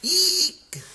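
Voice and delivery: very high-pitched eek; piercing mock-scared squeak; frightened squeal. A narrator's reading. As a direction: squeal it like a terrified bear. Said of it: "squealed the bear".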